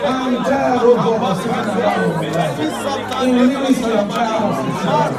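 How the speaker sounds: loud, high shouted prayer lines; fiery prayer leading. Acted. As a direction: cry each line out for them to repeat. Leads shouted congregational prayer.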